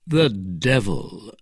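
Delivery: slow, thoughtful murmur of surprise; musing. Acted. Athos mulling over the news, musing. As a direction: draw it out, thinking. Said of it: "said Athos musingly".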